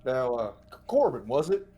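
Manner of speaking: lightly voiced friendly big man; a hint of folksy swagger; Cal approaching. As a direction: amble up and check the name. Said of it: "Cal's gonna walk up to Corbin".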